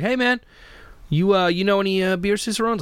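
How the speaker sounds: high, mock-eager voice of the bar owner; quoting the owner. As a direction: play the owner, pitched well up. Owner quoted in retold exchange.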